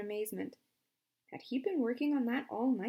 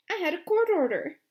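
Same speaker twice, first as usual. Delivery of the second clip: indignant retort; 9 semitones higher, 10 dB louder than usual. Acted. Lawyer arguing with warden.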